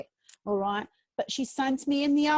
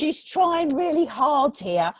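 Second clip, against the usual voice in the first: slowed, louder channelled voice as the aunt pushes through; spirit coming through; 3 semitones higher, 5 dB louder, slower than usual. Pretend. Medium to sitter, aunt coming through, slow and louder. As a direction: let the aunt's presence slow and swell your voice. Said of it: "my voice is changing".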